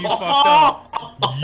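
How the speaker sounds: high, loud and slow, cracking up with fake outrage; laughing mock outrage. Real, not acted. Host laughs at co-host betting against Nate, mock outrage. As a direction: laugh out a drawn-out accusation. Friend bets against his guy.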